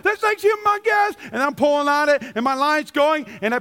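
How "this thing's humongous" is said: very high shout of amazement; excited shout. Retold or imitated. Fisherman shouting to the crew, very high excited shout. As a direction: yell how big it is. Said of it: "I'm like".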